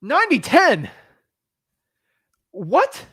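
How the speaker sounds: high, loud disbelief; can't believe the offer; incredulous. Real, not acted. Outraged repeat of the split offer.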